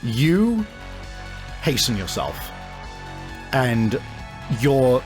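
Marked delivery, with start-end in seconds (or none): none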